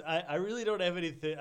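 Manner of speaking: ordinary talk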